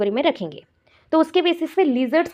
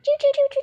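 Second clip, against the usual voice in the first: very high squeaky chu chu; mouse squeak. Shown as a demonstration. Teacher squeaks like a mouse for students. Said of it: "माइस क्या करता है".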